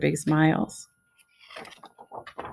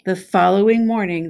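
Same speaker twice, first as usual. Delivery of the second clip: ordinary delivery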